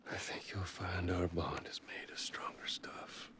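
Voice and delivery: quiet defiant whisper to the voice. Acted. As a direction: whisper back, unshaken. Hero answers the creepy voice.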